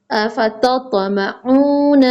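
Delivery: slow अफततमऊन with a bounced त; qalqala bounce. Shown as a demonstration. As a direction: let the त in अफततमऊन rebound. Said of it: "कलकला करेंगे".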